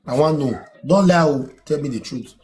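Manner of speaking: ordinary talk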